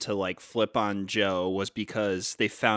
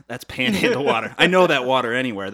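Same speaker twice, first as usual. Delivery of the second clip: emphatic louder; 3 semitones higher, 8 dB louder than usual. Retold or imitated. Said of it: "That guy says".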